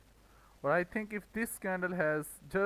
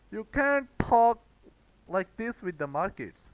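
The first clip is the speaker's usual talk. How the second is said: loud, higher, building outrage peaking on "can't"; angry rant; 6 semitones higher, 7 dB louder than usual. Real, not acted. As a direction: scold the trader who thinks he can boss the market. Ranting at impatient traders.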